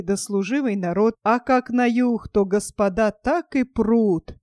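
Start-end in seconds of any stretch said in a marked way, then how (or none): none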